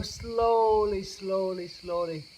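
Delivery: slowly, with long drawn-out vowels; slow motion. Shown as a demonstration. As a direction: stretch each word right out, easing downward. Guiding gaze gradually back down.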